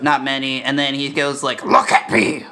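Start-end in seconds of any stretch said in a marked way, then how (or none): 1.7-2.5 low, rough Joker roar; sudden guttural villain voice; Heath Ledger's Joker. Retold or imitated. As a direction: imitate the Joker's menacing, distorted yell. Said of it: "like Keith does".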